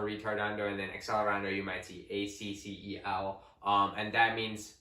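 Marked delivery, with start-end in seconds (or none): none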